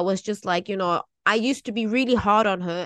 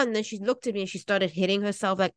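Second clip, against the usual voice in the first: usual speaking voice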